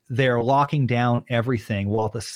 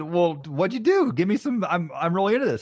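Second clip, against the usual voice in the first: eager interest; 7 semitones higher than usual. Retold or imitated. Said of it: "I said".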